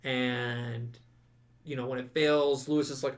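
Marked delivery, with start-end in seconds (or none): none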